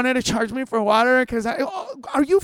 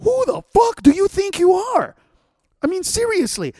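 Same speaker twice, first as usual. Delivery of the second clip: loud, high, furious tirade at whiny customers; furious rant; 5 semitones higher, 7 dB louder than usual. Retold or imitated. Ranting at entitled Yelp reviewers, loud furious shouting. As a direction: let it rip, voice cracking with outrage.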